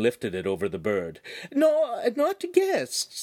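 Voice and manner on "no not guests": much higher, gently refusing lady; a refined lady politely declining; signora's voice. Acted. Signora turning down the butcher's beef heart, much higher.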